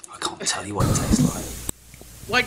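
hushed whisper while tasting. Real, not acted. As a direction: whisper it under your breath.

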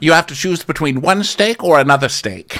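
high, grand put-on voice; hammed-up haughty approval; [name]. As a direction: savor it with theatrical poshness.

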